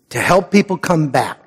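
normal talking voice

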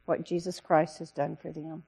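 ordinary talk, nothing marked

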